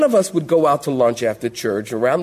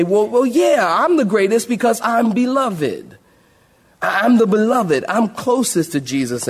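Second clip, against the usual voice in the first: preening John; 6 semitones higher than usual. Retold or imitated. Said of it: "And then John might say".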